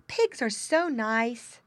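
put-on high, slow girlish coo; admiring girl. Girl admiring pigs, adoring. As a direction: draw it out adoringly.

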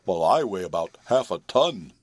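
mildly scornful horse voice. A narrator's reading. Horse comparing himself with the kitten, mildly scornful. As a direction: stress the contrast with light disdain. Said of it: "in a scornful tone".

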